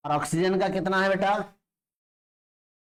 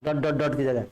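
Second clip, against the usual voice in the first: ordinary delivery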